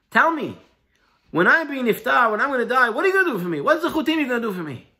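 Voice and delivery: put-on high-pitched, urgent old-father demand; insistent questioning. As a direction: press the son for an answer, pitched high. Father presses son about his death.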